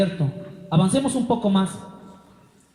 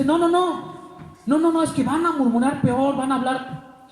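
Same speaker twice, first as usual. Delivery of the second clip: high anxious pleading, no no no; pleading Moses; 5 semitones higher than usual. Acted. Moses pleading with God not to destroy the people. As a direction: beg quickly, raised voice.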